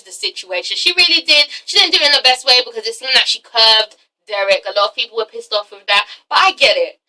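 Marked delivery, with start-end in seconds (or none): none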